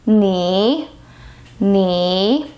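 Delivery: 你 dipping to the bottom of the range and rising; third tone. Shown as a demonstration. Teacher demonstrates the third tone, low dip then rise. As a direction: sink as low as possible on 你, then lift. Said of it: "The lowest point of your voice range".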